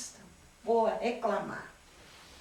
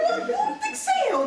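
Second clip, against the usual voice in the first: much higher, urgent and eager; a high, excited insistence; eager volunteering; 3 dB louder than usual. Retold or imitated. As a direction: jump in before anyone else can. Eager self-volunteering, laughing.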